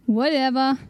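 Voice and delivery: plain reading voice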